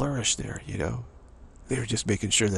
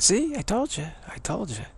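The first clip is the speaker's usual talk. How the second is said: told-you-so; 11 semitones higher than usual. Acted. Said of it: "And then he said".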